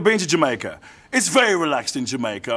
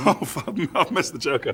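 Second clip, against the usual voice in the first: ordinary talk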